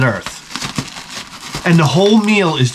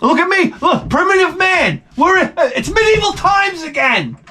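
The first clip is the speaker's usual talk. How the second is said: high mock outrage; 12 semitones higher than usual. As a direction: shout it like a caveman joke. Mock primitive man outrage.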